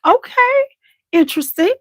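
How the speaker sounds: high, piqued okay interesting; intrigued. Retold or imitated. Retelling first look, high intrigued. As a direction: sound hooked and curious.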